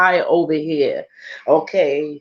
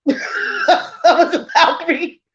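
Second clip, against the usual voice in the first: laughing; 10 semitones higher, slower than usual. Real, not acted. Laughs at own claim.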